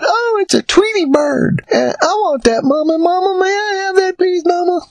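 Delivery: put-on high-pitched excited child begging mama; squeaky kid voice, wheedling; pleading little kid. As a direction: beg like a small child spotting a toy. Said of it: "I don't know if I talked like that when I was a kid".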